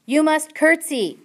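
loud high shout. A narrator's reading. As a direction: bellow the instruction at her. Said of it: "shouted Louise".

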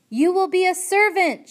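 put-on loud high shout at a half-deaf girl. A narrator's reading. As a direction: bellow it so she can hear. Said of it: "shouted the soldier".

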